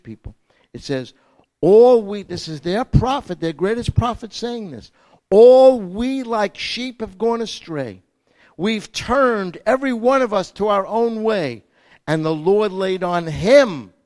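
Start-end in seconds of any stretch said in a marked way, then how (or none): 1.6-2.2 loud proclaiming. Acted. 5.3-13.9 solemn booming scripture recital; proclaimed scripture. Acted.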